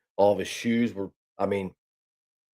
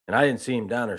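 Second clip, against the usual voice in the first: ordinary talk, nothing marked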